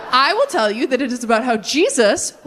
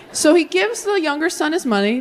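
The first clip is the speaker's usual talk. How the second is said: usual speaking voice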